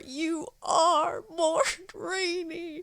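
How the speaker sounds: slow, high, crying repeat of the name; tearful repeating. Retold or imitated. Wife keeps repeating it to him, slow high tearful voice. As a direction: whimper it out slowly through tears. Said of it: "she just keeps saying it over".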